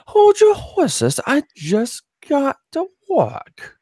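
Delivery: very high, slow mock English accent; squeaky, drawn-out put-on English accent; attempted English accent. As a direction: stretch it out in a bad English falsetto. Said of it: "trying to do an English accent".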